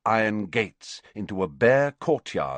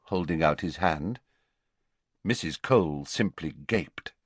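ordinary delivery

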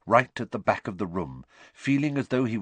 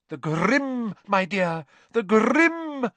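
very high, swelling theatrical cry; high-pitched melodramatic alarm; dramatic cry; 12 semitones higher, 5 dB louder, much slower than usual. A narrator's reading. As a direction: cry out the dreaded word as a shocked seer. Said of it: "cried Professor Trelawney".

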